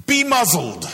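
high, loud "Be muzzled" to the sea; sharp command. A narrator's reading. Jesus commanding the sea, high loud sharp order. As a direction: bark it at the storm. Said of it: "He said to the sea".

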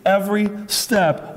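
ordinary delivery

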